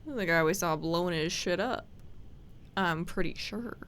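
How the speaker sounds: normal talking voice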